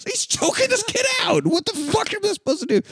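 very high, strained exasperation; hammed-up, high-pitched helplessness; mock panic. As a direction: plead your case in a squeaky panic. Retold panicked reply to other teachers.

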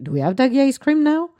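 high, loud, thrilled disbelief; amazed at the store shelf; excited surprise. Retold or imitated. Asking the clerk, loud and high with excitement.

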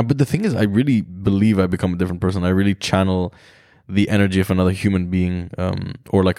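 usual speaking voice

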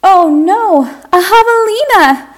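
high, loud horrified shriek; shrill panicked cry of a crowd; dancers screaming. Acted. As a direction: scream it as the music stops. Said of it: "Dancers screamed".

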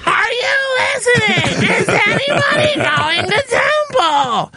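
hammed-up high, drawn-out wail; mock screaming. Dream friend in a stairwell, screamed whiny wail. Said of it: "still screaming at the top of her lungs".

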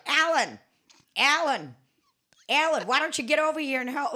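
high, loud Wisconsin holler, imitating; attempted Wisconsin accent. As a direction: call out in a rough Wisconsin accent. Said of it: "can't do a fucking Wisconsin accent".

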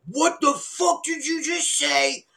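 high, slow, threatening challenge; angry demand. Retold or imitated. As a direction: squares up and demands an answer. Angry guy gets in his face.

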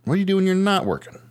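high, fast, pushing disbelief; incredulous pressing. Real, not acted. Host pushing cohost about his free time, high and fast. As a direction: badger him for an answer, pitch up.

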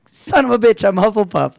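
mock dismay. Reader realising his own house, in mock dismay. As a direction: cry out in playful despair. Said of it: "And I was like".

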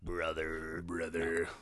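low, soft wrestler growl, lightly done; a hint of a menacing wrestler; Kane impression. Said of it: "talking like Attitude Era Kane".

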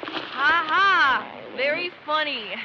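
sarcastic laugh. Acted. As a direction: scoff at the joke with a fake ha ha. Mocking a fake map joke.

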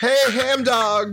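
"Ham Dog" drawn out high and slow, folksy southern president; Bill Clinton. Retold or imitated. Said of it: "Do you think Bill Clinton calls Hammond".

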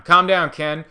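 mock wife pleading calm down; wife calming him. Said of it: "telling him to calm down".

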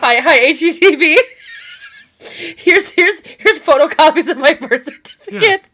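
high, loud, giggly fake phone pitch; over-eager pitch spluttered through laughter; laughing mock caller. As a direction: play the eager caller while cracking up.

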